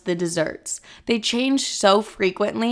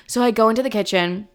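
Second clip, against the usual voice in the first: usual speaking voice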